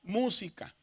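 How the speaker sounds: "Música" with the stress on MÚ; "Música", first syllable strongest, the rest falling away. Shown as a demonstration. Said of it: "donde se pronuncia más fuerte".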